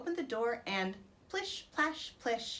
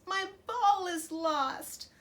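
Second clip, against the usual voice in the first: higher, louder, slow pleading wail; wailing princess; 5 semitones higher, 4 dB louder than usual. Acted. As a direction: beg tearfully for the lost ball. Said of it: "wailed the princess".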